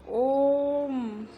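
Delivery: ओम drawn out very long; chant-like stretched ओम as a pluta vowel; prolonged vowel. Shown as a demonstration. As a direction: hold the ओ in ओम for many beats. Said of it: "तो बहुत ज़्यादा time लग रहा है".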